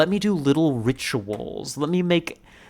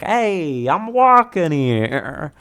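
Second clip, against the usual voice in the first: brash New York shout, higher and drawn out; New York accent; 6 semitones higher, 7 dB louder, much slower than usual. Acted. New Yorkers yelling at a new arrival, brash New York accent. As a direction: holler it slowly in a broad New York accent. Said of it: "You get sent to New York, and they're like".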